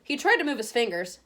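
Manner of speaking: ordinary delivery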